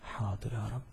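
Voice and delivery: low, very soft reply with a lift; hushed, humble assent; meek obedience. Acted. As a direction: answer submissively, under your voice. Acted meek reply to God.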